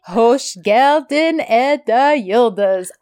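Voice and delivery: very slow, drawn-out sarcastic greeting; mock sarcastic welcome. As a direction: imitate an exaggerated, mocking welcome. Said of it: "that's exactly how he said it".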